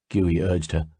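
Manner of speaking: ordinary delivery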